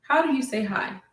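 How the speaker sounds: ordinary talk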